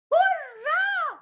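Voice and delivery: hurrah sung up high and bright; high cheer. Acted. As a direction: cheer it like a happy child. Cheer inside the song.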